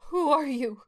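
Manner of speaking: a frightened mother asking the robed figures; begging. A narrator's reading. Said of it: "she begged".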